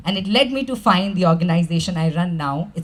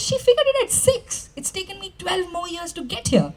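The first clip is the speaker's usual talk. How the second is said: higher and squeaky in disbelief, then fading softer; mock astonished; 10 semitones higher, 6 dB quieter than usual. Marvelling at a six-year-old's lesson in a high mock voice.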